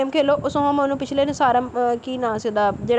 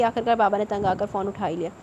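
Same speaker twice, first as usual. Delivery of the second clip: ordinary delivery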